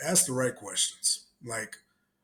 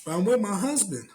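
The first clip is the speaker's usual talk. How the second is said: higher, coy refusal; mock Kamala; 7 semitones higher, 3 dB louder than usual. Kamala refusing Obama again, higher coy voice. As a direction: play the hesitant wife.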